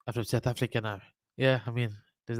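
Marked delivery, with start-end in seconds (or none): 0.1-1.0 put-on South African accent. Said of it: "Does that South African accent work?".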